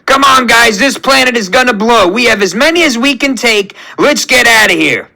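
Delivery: high, urgent call to evacuate; Rocket shouting. A narrator's reading. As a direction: hurry the crew out before disaster. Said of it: "as Rocket shouts".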